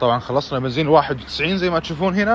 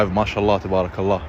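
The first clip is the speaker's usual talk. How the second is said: ordinary talk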